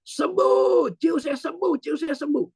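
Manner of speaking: high-pitched triumphant cry, first word held long; re-enacted shout. Preacher re-enacting his midnight prayer for a sick relative, high shout. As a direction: cry out the healing in a high, ringing voice. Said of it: "saya teriak jam setengah dua belas".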